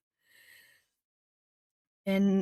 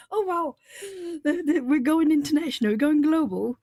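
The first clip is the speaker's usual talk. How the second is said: excited; 6 semitones higher, 4 dB louder than usual. Real, not acted. Thrilled at international viewers.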